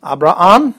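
Abraham as "ah-bra-AHM", second A separate; both A's pronounced. Shown as a demonstration. Teacher models the Greek name, both A's pronounced. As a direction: say Abraham with two distinct A sounds. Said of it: "you pronounce both of them".

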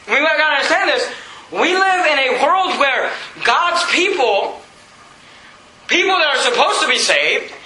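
high, insistent sermon delivery; forceful preaching. Real, not acted. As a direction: drive each phrase home to the congregation.

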